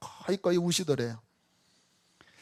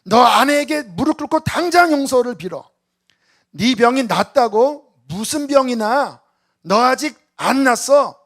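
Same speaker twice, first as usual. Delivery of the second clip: prophetic rebuke; 6 semitones higher, 8 dB louder than usual. Retold or imitated. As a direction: thunder a warning at the old husband. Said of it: "막 이게 나가는 거예요". (높고 큰 소리로 엄하게 꾸짖는 호통)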